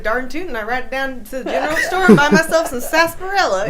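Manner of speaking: loud, high mock Texan cowboy; exaggerated Texan accent, laughing through it. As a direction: lay on the Wild West twang for the Brits. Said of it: "I even responded with".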